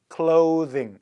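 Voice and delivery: clothing said "KLOH-thing" with voiced th; correct long o. Shown as a demonstration. Teacher repeating the correct reading, clothing with long o. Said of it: "이렇게 읽어요".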